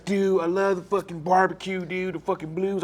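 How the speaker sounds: high, excited mock-fan voice, hammed up; gushing tourist impression. Comedian mocking outsiders' cliché barbecue-and-blues gushing, high and excited. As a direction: gush like a clueless out-of-towner raving about Memphis. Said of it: "they say".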